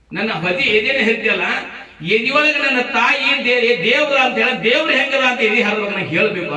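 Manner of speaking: high, loud acted child's appeal; impassioned demand. Child asks surgeon to look for God, insistent.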